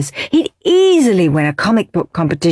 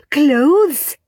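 higher eager call; shouted; 9 semitones higher, 3 dB louder than usual. Acted. Gorinda shouting guess at prize, eager call. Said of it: "shouted Gorgeous Gorinda".

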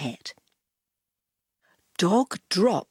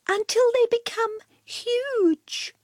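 high, dramatic cat voice. Acted. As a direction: build it up like a storyteller cat.

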